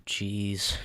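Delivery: usual speaking voice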